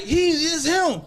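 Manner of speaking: high, drawn-out mock holler; retold crowd yell. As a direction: imitate bystanders calling him out, stretched and high. Crowd pointing him out, retold.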